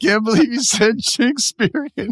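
high, breathless laughing voice; laughing hard. Real, not acted. Host cracking up at his co-host's joke, breathless and high. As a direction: squeeze the words out between laughs. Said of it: "I'm gonna have an asthma attack".

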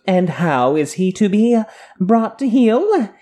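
mock-refined, lilting delivery; haughty character voice. Acts a haughty character's question.

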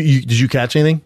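ordinary talk, nothing marked

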